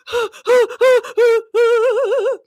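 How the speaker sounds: loud, high repeated gasps; hyperventilating fan gasps; shocked gasps. Real, not acted. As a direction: gasp in rising shock. Shocked on seeing who is there.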